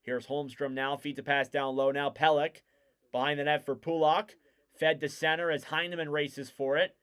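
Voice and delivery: brisk hockey commentary, rising on chances; live play-by-play. Real, not acted. Commentator calls the Islanders game for listeners, live play-by-play. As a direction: follow the puck, lifting the call as danger builds.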